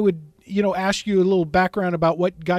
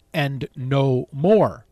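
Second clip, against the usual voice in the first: "and no more" drawn out slowly, each word weighted; slow down and lean on "and no more"; emphatic slow reading; 5 semitones lower, much slower than usual. Acted. Reading the key caveat with emphasis.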